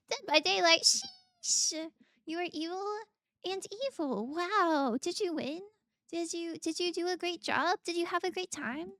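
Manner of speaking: loud, high, eager chatter; excited questions. Real, not acted. Streamer asking a viewer about their game with loud excitement.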